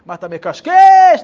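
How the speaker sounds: heckler's shout. Retold or imitated. Kibbutz heckler yelling at the young rabbi, furious shout. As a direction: bellow it at the speaker from the crowd. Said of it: "התחיל לצעוק עליו".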